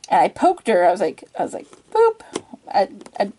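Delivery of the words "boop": high, playful little boop; cute boop. Shown as a demonstration. Poking the puppy to see if it moves, with a high cute boop. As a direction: chirp it like poking a puppy's nose. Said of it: "I didn't say poop when I did it".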